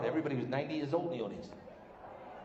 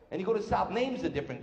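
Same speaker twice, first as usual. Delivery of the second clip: normal talking voice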